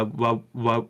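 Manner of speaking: ordinary talk, nothing marked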